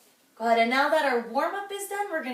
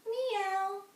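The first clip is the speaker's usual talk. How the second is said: high kitty meow; playful cat sound; 7 semitones higher than usual. Shown as a demonstration. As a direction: mew for the class. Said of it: "we're gonna be a cat".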